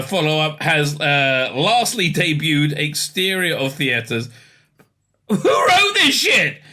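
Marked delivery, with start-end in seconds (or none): 5.3-6.6 high, slow, crying-out shout; exasperated yell. Real, not acted. Wailing at the screen over the garbled article. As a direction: wail it at the screen in despair.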